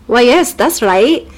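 put-on slow, amateur Scottish accent; attempted Scottish accent. Macbeth confirming to the witches, slow mock Scottish accent. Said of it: "I could almost do a Scottish accent".

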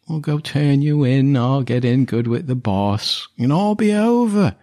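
rough-and-ready henchman, pitch climbing as he bargains; wheedling villain Frank. Acted. Hurt villain urges party to give up, wheedling. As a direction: coax them into surrender. Game master plays Frank.

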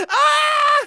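pained scream. Acted. Pretend hit.

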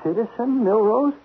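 nervous laugh of disbelief at being demoted; aghast disbelief. Acted. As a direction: react in horror to the demotion.

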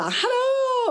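high, loud sing-song hello; a bright shouted hallå from the gym coach; cheerful trainer greeting. Retold or imitated. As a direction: greet like an over-happy trainer. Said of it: "står ju där och bara".

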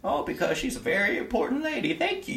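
put-on high, thin voice of a hunched old servant; old gentleman. Old servant thanking the player, high and meek. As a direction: play the bent old gentleman, humble and grateful.

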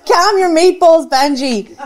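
loud action hero. Acted. Ethan snaps back at Benji.

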